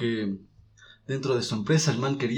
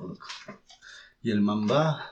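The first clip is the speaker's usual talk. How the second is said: ordinary delivery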